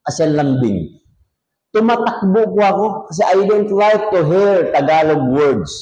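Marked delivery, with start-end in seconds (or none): none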